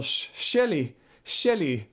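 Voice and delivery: "Shelley" with Scottish vowels; Glaswegian pronunciation. Shown as a demonstration. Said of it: "that's how they pronounce Shelley".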